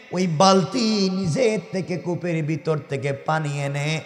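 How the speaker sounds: ordinary talk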